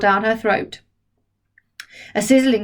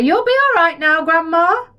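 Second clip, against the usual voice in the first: put-on higher, louder cheerful boy; 8 semitones higher, 5 dB louder than usual. George cheers Grandma up, higher and louder. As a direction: cheer her up.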